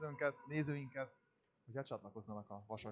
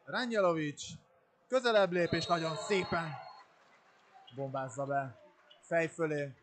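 loud, high play-by-play building to goal; excited hungarian sports commentary; 3 semitones higher, 6 dB louder than usual. Real, not acted. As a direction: call the attack as it scores. Commentator calls another goal. (izgatott, hangos helyszíni közvetítés)

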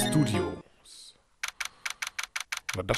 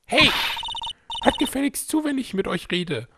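put-on grumpy old lady voice; 12 semitones higher than usual. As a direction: bark it like a nosy old guard. Player voices the guard Oldbag.

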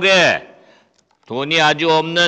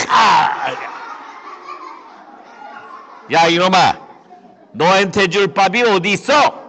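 scolding. Acted. Rich man scolding the beggar for food. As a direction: tell the beggar off.

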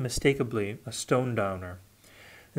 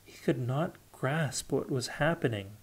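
ordinary delivery, nothing marked

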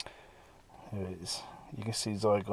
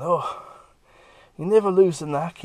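higher and louder, chuffed with himself; Duggan pleased; 8 semitones higher, 9 dB louder than usual. Retold or imitated. Duggan on the floor after the breakout, lightly voiced gloating. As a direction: crow it from the floor, proud of the old skill.

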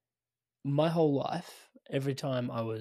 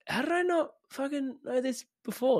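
much higher, stunned at oneself; amazed disbelief; 12 semitones higher than usual. Retold or imitated. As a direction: relive the shock of discovery. That for me was just like.

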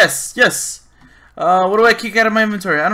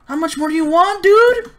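exasperated; 11 semitones higher, 6 dB louder than usual. Real, not acted. Gamer feeding a parrot that won't be tamed, exasperated. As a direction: voice climbing very high and loud.

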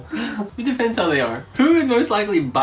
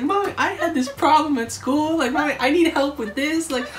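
put-on much higher, pleading child voice with an accent; kids with an accent; 9 semitones higher than usual. Kid asking Mommy for homework help, high accented child voice. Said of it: "why they have an accent".

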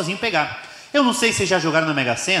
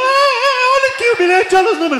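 frantic insistence; 4 dB louder than usual. Acted. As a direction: squeal it, begging him to look. Ricardo frantically showing the ticket.

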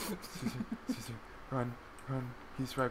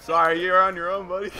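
loud, raised-pitch taunt; gleeful shout; 7 semitones higher, 12 dB louder than usual. Real, not acted. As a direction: shout it at a teammate you're ditching. Abandons teammate outside closed door.

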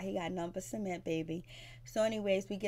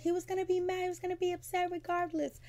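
much higher, mimicking her whine; mocking high voice; 11 semitones higher than usual. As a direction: squeak her excuse up high. Anais quoted, high mocking voice.

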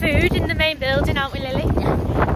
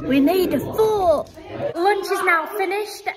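exclaiming; 6 semitones higher, 4 dB louder than usual. Real, not acted. Exclaiming at lunch.

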